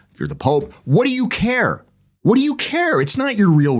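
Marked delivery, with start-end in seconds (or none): 0.8-1.8 P=A; mocking scoff. Mocking the indifferent clergy. 2.2-3.0 P=A; higher mocking scoff. Repeats the mocking question.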